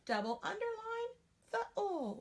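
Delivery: put-on high childlike voice; teacher playing the trained student who knows the marking. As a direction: answer brightly like a drilled pupil. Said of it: "the student who's trained would do".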